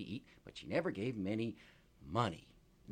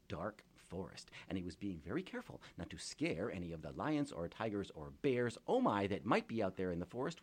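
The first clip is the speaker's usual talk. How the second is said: plain reading voice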